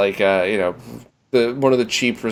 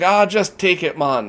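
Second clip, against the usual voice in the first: imitated Jamaican accent, pitched up; 6 semitones higher, slower than usual. Seller handing the tourist the bag, in a Jamaican accent. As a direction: give in, in a put-on Jamaican accent. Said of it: "that Jamaican accent you did".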